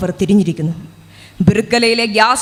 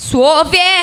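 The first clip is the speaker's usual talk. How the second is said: put-on old woman calling; 4 semitones higher, much slower than usual. Grandmother calling Sophie from her bed. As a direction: call out for Sophie.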